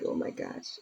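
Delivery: breathy whisper of dismay; whispered oh my gosh. Retold or imitated. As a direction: whisper it, moved. Reacting to sad TV scene.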